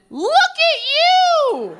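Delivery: very high, squealed cooing; gushing praise. Retold or imitated. Man praising the woman on top, high cooing. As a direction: coo it way up high, like praising a toddler. Partner's exclamation during sex, acted.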